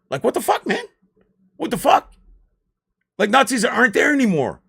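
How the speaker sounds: high, loud indignant outburst; outraged disbelief. Real, not acted. Ranting to viewers about history, loud indignant outburst. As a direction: throw up your hands at the absurdity.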